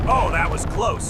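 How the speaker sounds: ordinary talk, nothing marked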